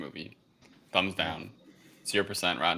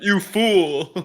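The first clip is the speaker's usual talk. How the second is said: high, loud hammy villain shout; mock villain; 12 semitones higher, 9 dB louder than usual. Mocking the movie villain's overacted shout. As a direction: ham it up like a cartoon villain.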